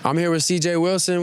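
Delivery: normal talking voice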